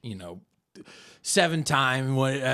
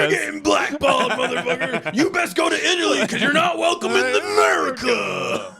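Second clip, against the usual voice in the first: much higher, shouted tirade; mock patriotic shout; 12 semitones higher, 5 dB louder than usual. Villain banishing a suspect abroad, hammed-up yelling. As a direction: rant like a hammy red-scare villain.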